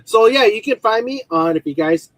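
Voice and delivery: ordinary delivery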